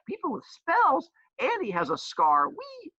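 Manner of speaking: high, slow gushing voice, squealing the wee; mock excited reader. Teacher imitating a student just discovering Harry Potter, high and gushing. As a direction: play a wide-eyed newbie thrilled by magic. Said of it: "and you're like".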